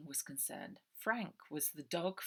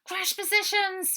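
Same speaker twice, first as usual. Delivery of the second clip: loud high shout of alarm; urgent bellowed command; 10 dB louder than usual. A narrator's reading. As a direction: yell the order for crash positions. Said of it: "shouted Ida".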